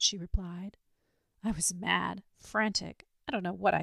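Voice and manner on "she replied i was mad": plain reading voice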